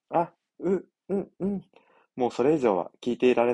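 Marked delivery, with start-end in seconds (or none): 0.1-1.6 high breathy muffled moans; coy moaning teacher. Acted. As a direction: moan high and small. Teacher moans being kissed.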